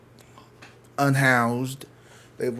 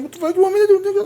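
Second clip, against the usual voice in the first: very high, loud mock protest; incredulous protest; 9 dB louder, much faster than usual. Bystander answering the man staring at him, high mock protest. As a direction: squeal it in exaggerated helplessness.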